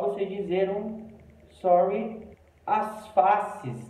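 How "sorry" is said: "sorry" lifted at the end like a question; questioning intonation. Shown as a demonstration. Foreigner who didn't understand fast speech, a questioning, rising "sorry". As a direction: make "sorry" rise, asking for a repeat. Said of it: "e a entonação também".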